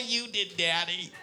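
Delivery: put-on slow, laughing little girl reply; excited kid answer. River answering dad, slow excited giggle. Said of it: "she goes".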